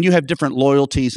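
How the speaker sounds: ordinary delivery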